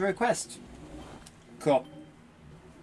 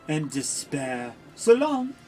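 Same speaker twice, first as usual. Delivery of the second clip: villain Dark Lord voice. Acted. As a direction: gloat like a cartoon dark lord.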